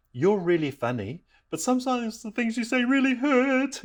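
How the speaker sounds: put-on high little-girl voice; Nicole. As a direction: imitate a hurt child, rising to a squeak. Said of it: "My daughter Nicole goes".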